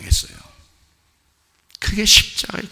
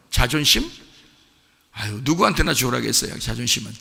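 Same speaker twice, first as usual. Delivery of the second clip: normal talking voice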